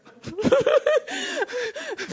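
a high, loud shrieking laugh; helpless high laughter; high burst of laughter. Real, not acted. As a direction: laugh out in disbelief. Laughing in disbelief.

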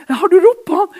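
hammed-up eager question. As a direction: ask it excitedly.

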